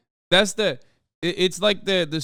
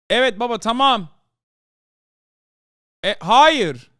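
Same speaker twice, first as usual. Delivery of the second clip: higher and louder, shouting to someone in another room; calling out to dad; 10 semitones higher, 8 dB louder than usual. Real, not acted. Streamer answering his dad from another room, calling out loud. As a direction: call out to dad across the house.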